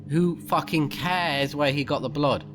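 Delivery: ordinary talk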